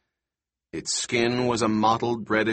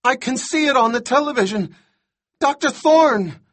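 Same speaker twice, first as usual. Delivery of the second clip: very high, loud frantic voice; hysterical shouting; 13 semitones higher, 6 dB louder than usual. A narrator's reading. Boy on radio watching the monitor, hysterical shouting. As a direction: shriek the warning in panic. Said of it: "He sounds completely hysterical".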